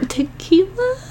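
confused question. Retold or imitated. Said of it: "I was like".

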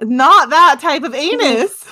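mock exasperated. Real, not acted. Deadpan correction, loud and slow.

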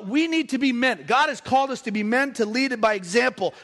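usual speaking voice

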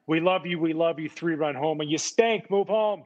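imitating a Boston crowd yelling, hammed-up; mock fan yell. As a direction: holler it like a fan turning on you. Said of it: "they're way quicker to yell at you".

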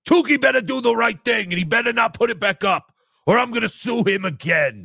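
put-on loud, bullying voice; imitating a threat. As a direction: mimic a blowhard making legal threats. Acts out John's threat.

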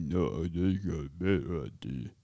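low, soft mumbling impression; Rocky. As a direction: mumble it low, like the old boxer. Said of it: "And Rocky's like".

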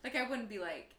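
normal talking voice

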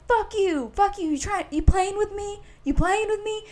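high-pitched mock angry yelling; imitated screaming. Stranger screaming at the couple, high mock yell. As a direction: rant at him like the raging stranger. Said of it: "like, screaming".

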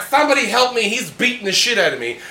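mock the cousin begging for help; the beaten cousin, hammed-up pleading; whiny cousin. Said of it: "He's like".

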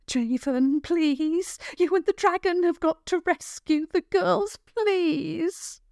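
high, urgent begging, voice climbing; desperate mother's plea. Acted. Frantic mother begs Javen to rescue the girls, high desperate plea.

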